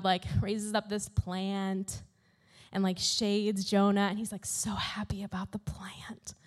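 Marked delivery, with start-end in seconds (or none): 4.1-6.3 soft, breathy whisper, almost secret; hushed, conspiratorial telling; whispered aside. Real, not acted. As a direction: lean in and whisper it. Storytelling aside about Jonah.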